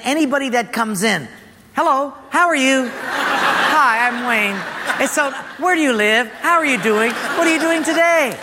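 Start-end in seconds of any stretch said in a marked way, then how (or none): 1.8-8.4 high, over-eager chatter; eager greeting. Retold or imitated. Lonely retreat escapee greeting cafe strangers, over-eager. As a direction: gush at every stranger who walks in.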